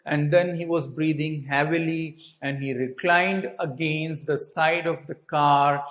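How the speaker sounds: ordinary talk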